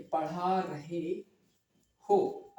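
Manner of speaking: पढ़ा रहे stretched out very slowly, then a pause before हो. Shown as a demonstration. Teacher isolating the verb phrase for students, very slowly. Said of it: "ठीक है".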